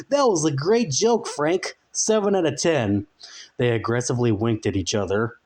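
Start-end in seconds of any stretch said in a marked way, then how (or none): 0.1-3.1 higher, tiny clock man voice; tiny wrinkled man. A narrator's reading. The tiny clock man praises Frank, high. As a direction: pop out and rate the joke. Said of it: "wrinkled human stuck his head out and said".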